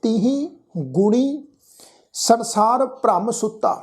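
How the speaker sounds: slow, measured Gurbani recitation with drawn-out vowels; scripture recitation. Shown as a demonstration. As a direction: recite the sacred line word by word, stretching the endings. Recites the verse for students. (ਹੌਲੀ, ਲਮਕਾ ਕੇ ਗੁਰਬਾਣੀ ਪਾਠ)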